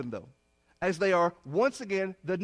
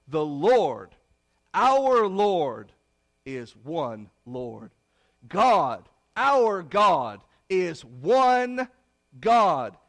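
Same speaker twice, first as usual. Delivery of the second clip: much louder, proclaiming each word; forceful preaching; 8 dB louder, much slower than usual. Acted. Preacher proclaiming the Shema to congregation, loud full-force preaching. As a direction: ring it out like a creed from the pulpit.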